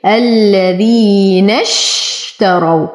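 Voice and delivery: الذين اشتروا slowly, the ياء held two beats; slow tajweed demo. Shown as a demonstration. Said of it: "مد طبيعي حركتين". (تلاوة بطيئة بمد طبيعي)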